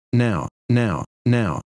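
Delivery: lightly voiced clock ticking out each now; a hint of a clock's tick, word by word. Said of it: "every tick of it seems to say".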